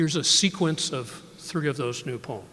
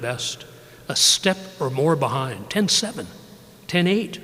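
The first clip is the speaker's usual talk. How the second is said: measured, unhurried poem recital; poetry reading; 8 dB louder than usual. Acted. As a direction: let each line land, then pause. Poet reads his poems.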